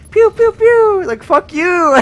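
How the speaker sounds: high, loud playful pew pew; gun noises. Retold or imitated. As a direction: make toy gun sounds like a kid. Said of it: "I was like".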